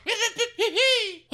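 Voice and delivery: squealing laugh; high-pitched shocked laugh. Acted. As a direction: burst out in a shrill laugh. Host reacts to crotch kick.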